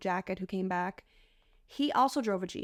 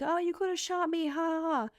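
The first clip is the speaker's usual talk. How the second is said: high mock giggly voice; 8 semitones higher than usual. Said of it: "Brenda's like".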